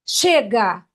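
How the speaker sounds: drawn-out, forceful cry; demonstrated shout. Shown as a demonstration. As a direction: shout it like breaking out of chains. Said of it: "eu vou pedir para você gritar". (grito forte de libertação)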